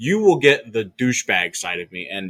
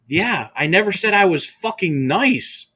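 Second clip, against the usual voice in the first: retold retort, raised; 8 semitones higher, 5 dB louder than usual. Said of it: "I'm like".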